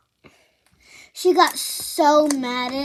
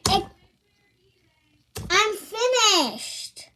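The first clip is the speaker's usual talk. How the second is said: mock stepmom voice; 6 semitones higher, slower than usual. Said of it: "and she was like".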